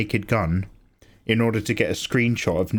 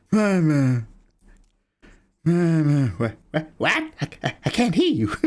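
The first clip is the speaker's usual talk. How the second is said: mock calling mama; 4 semitones higher than usual. Streamer voicing a cartoon character calling for mama. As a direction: call out then stumble as if confused.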